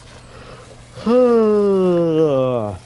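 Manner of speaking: big loud yawn; a long, audible yawn. Real, not acted. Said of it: "Excuse my yawning".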